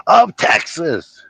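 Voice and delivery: high, blustering sheriff on the radio; Jackie Gleason. Acted. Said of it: "I'm pretty good at doing Jackie Gleason".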